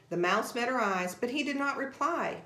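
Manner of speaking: ordinary delivery, nothing marked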